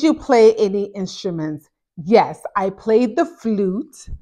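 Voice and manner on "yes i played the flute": ordinary talk, nothing marked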